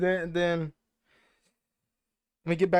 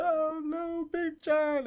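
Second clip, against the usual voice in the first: mock crying; 11 semitones higher, much slower than usual. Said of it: "he starts crying".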